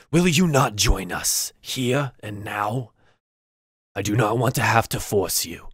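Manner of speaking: slow, lightly voiced shaman menace; veiled threat. Shaman warns the orc to join or be forced, slow mild menace.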